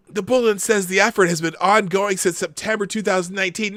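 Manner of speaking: ordinary talk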